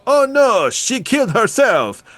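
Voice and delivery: high, hammed-up French-accented dismay; bad French accent. French cop finding her dead, struggling French accent. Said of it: "with the French accent".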